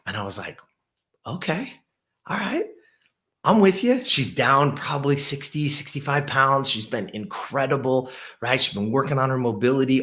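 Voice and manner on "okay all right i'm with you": higher, bright encouraging coach voice; eager coach reply. Retold or imitated. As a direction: pump her up for the pull-up. Coach answers client's pull-up request.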